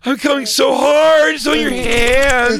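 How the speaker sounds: very high, loud, slow hammed-up orgasm; mock climax wail.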